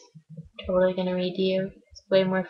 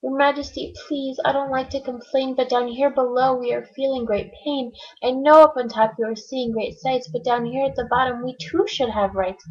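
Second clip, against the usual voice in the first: higher, pleading little turtle; higher, mild groaning plea from Mac; 4 semitones higher than usual. Acted. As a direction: complain politely but in pain. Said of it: "groaned Mac".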